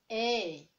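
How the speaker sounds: A drawn out as English "ay"; name the letter A in English, "ay". Shown as a demonstration. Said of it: "In English, we say". (A anglais, « éï » allongé)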